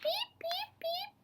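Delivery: tiny, very high beeps; peeper call. Acted. Storyteller voicing the peeper frog's tiny high chirp. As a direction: chirp like a little frog.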